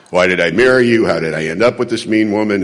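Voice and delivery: pacing and grumbling at an absent wife; angry muttering. Retold or imitated. Husband pacing in office arguing with wife, low grumble. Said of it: "arguing with my wife".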